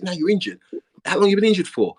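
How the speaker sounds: high, loud interrogation; imitated berating. Manager grills injured player, imitated loud high berating.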